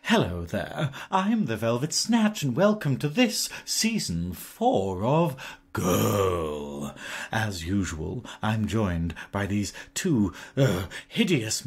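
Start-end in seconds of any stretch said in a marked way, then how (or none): 2.4-6.9 slow, drawn-out, lower showbiz welcome; grand host welcome. Acted. Drag host welcoming listeners to season four slowly.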